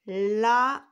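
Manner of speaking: lama in two loud, separated syllables; dictation sounding-out. Shown as a demonstration. Teacher repeating the word for pupils to write, syllables held apart. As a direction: repeat lama loudly, split as LA, then MA.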